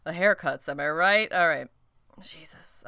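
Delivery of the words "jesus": breathy under-the-breath whisper. Real, not acted. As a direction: drop to a whisper. Whispered aside.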